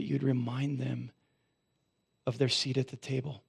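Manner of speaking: hushed sincere prayer; closing prayer. Real, not acted.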